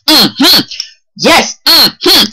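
high, punched "Mm-hmm"; emphatic approval. Shown as a demonstration. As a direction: hit each "Mm-hmm" hard and high. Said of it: "You see that emphasis there?".

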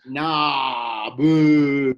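loud mocking boos; sneering panto villain. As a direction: boo the answer like a pantomime crowd. Teacher boos a wrong answer. (abucheo burlón de pantomima)

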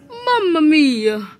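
slow mock-Italian accent. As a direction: put on a hammy Italian accent. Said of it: "I kinda like the Italian voice".

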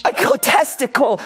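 high, incredulous echo; offended disbelief. Acted. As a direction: throw the insult back, pitched high. Offended by the insult.

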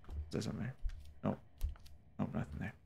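whisper. Real, not acted. Scared gamer checking dark room.

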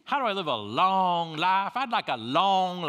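mock farmer begging loudly for a "long" life; drawled long. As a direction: pull "long" out like "lo-ong". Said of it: "I didn't know long had two syllables".

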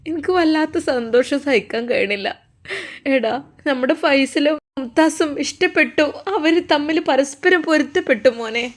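mother overjoyed, telling her son on the phone; joyful mother. Acted. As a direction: lift it up like a happy old mother.

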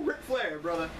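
a lightly voiced boastful wrestling champ; hint of a swaggering wrestler promo; Ric Flair impression. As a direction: strut through it like a cocky champion. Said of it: "Ric Flair would break into my house".

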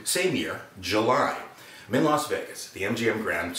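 usual speaking voice

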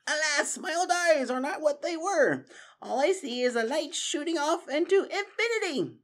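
put-on an old mouse regretting his weak eyes; Methuselah sighing. Methuselah lamenting his old eyes to friends, rueful high voice.

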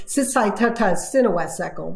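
"Sisai Tata Sinawasekal", a phrase spoken in reverse; backwards speech. Shown as a demonstration. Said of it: "this is what it sounds".

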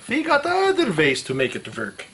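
high-pitched opening in a mock German accent, dropping back down. Tire changer joking about other ways to make it work, mock German accent. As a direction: put on a hammed-up German villain accent.

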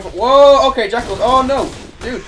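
loud, high cry of alarm; startled outburst. Real, not acted. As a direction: react as enemies suddenly appear. Startled by enemies in game.

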